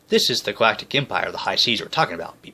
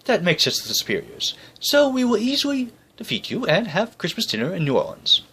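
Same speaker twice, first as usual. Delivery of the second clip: higher, pompous boasting; boastful British general; 10 semitones higher than usual. Acted. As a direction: gloat like a smug officer.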